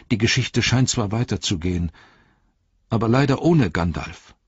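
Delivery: a quick rueful hobbit reply; hobbit Pippin. Acted. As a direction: Pippin telling the Ent the story goes on without Gandalf. Hobbit Pippin answers the Ent.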